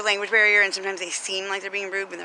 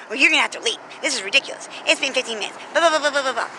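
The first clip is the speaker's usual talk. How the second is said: imitating a clerk yelling, louder and higher; mock the rude clerk's rant, building to a louder blah blah; retold yelling; 4 semitones higher, 5 dB louder, faster than usual. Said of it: "yelling at me".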